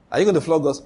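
usual speaking voice

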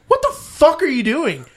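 high, loud yell at her window; yelling at the stoned driver in her car; angry yell. Retold or imitated. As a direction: shout her down. Confronting the woman in the car.

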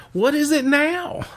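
high, drawn-out fed-up husband; mock exasperation. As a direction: play the husband interrupted once too often.

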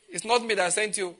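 normal talking voice

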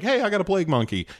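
high, fast mother's voice; quick squeaky caller voice; mock frantic mother. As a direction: rattle it off like a panicked mom. Mother calling in, quoted.

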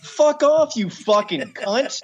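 high, laughing swearing rant; swearing tirade. Real, not acted. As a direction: vent in disbelief, laughing. Ranting about reality star.

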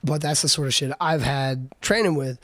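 ordinary delivery

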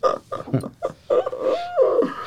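a long high-pitched burst of laughter; high squealing laugh. Real, not acted. Laughing at the end of his bicycle story, high squealing laugh. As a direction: let the laugh fly up high and trail off.

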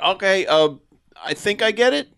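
tentative, high-pitched mock confusion; clueless alien. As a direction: play an unsure alien pretending to understand. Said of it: "this alien being like".

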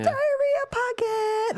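very high, slow, squeezed comic aside voice; Jim Gaffigan impression. Host mimicking a comedian's stand-up bit, high aside voice. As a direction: imitate a comedian's high-pitched self-commentary. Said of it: "When is he gonna whisper at himself like he does?".

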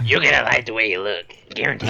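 lightly voiced gravelly announcer; a hint of a TV suit-store pitchman; Men's Wearhouse voice. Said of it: "I don't know if that was Men's Wearhouse".

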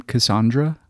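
Cassandra pronounced kuh-SAHN-druh; broad AH in the middle of Cassandra. Shown as a demonstration. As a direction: say Cassandra to rhyme with Sondra. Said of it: "she pronounced her name".

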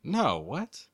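ordinary delivery

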